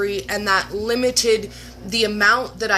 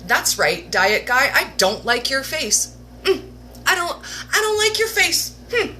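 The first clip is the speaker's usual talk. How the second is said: sassy challenge; 5 semitones higher than usual. Retold or imitated. Talking back to the diet guy video, sassy taunt. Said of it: "and I thought".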